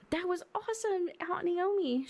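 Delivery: put-on high-pitched little kid voice; excited child. Child cheers the aunt after the rescue, high excited voice.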